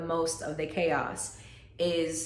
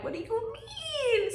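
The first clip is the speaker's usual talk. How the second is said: high shocked question; 3 dB quieter, slower than usual. Retold or imitated. Shocked by doctor's news.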